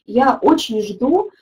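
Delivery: normal talking voice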